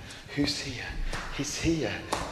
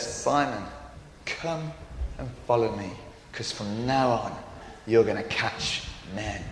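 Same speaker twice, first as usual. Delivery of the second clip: gentle call; 10 semitones lower, 7 dB quieter than usual. Acted. Jesus inviting the ashamed fisherman, gently. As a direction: call him tenderly to follow. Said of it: "He said".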